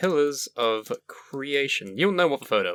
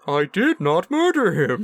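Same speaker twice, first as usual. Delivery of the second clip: bad robot impression; 7 semitones higher, 4 dB louder than usual. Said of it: "that's an inside joke".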